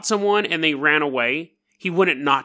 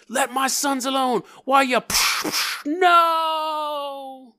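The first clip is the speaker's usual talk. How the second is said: high, strained cry of a father losing his sons; anguished hero; 10 semitones higher, much slower than usual. Pretend. Father crying out as his sons are taken, high anguished wail. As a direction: wail it out like a broken father in a trailer. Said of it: "I could see the movie trailer".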